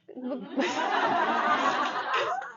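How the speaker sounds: high, drawn-out laugh; real burst of laughter; long laugh. Real, not acted. Teacher laughs after plugging their own book, amused.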